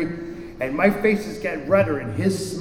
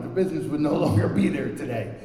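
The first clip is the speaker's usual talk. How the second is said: ordinary delivery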